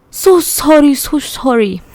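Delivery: loud, high mock Korean accent. Brother bowing and cracking up at his sister, Korean accent. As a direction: apologise in a put-on Korean accent. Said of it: "Tommy says in a Korean accent".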